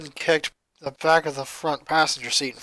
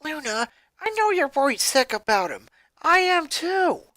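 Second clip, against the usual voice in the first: lightly voiced Lori; 9 semitones higher than usual. Lori answers.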